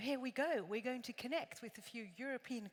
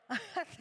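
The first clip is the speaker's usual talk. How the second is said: bright high laugh; quick delighted giggle; short laugh; 8 semitones higher, 4 dB louder than usual. Real, not acted. Laughs after wishing to go.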